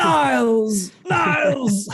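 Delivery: high shout calling out Niles; Frasier shouting. Retold or imitated. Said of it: "it's like Frasier's quitting his job".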